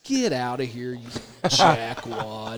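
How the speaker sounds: low, slow mock brush-off; mock dismissive. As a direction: shoo him off, laughing. Dismissing the player after.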